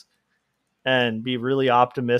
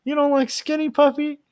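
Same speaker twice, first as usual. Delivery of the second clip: put-on shocked goth kid; 14 semitones higher than usual. Goth kid can't believe Dracula dislikes his bands, high shocked voice. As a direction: squeak out the betrayal. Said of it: "He's like".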